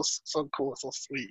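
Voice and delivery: breathy, dreamy whisper; swooning whisper. Retold or imitated. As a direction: sigh it out like a crush. Gushing over a sweet ending.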